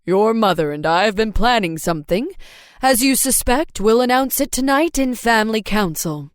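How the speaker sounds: a calm father explaining plans; dad voice. A narrator's reading.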